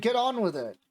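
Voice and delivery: exasperated, understated yell; mild acted shout. A narrator's reading. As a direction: urge them on with a half-hearted shout. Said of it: "I shouted".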